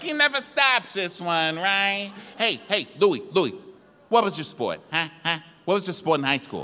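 high-pitched, needling imitation; Gorga. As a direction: pester him with rapid-fire questions. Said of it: "So Gorga's like".

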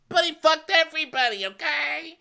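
high, fast, loud mocking squeal; mocking Ramona. Ramona snapping back at Kyle in a high mock voice. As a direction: squeal it like a catty reality star. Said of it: "And Ramona goes".

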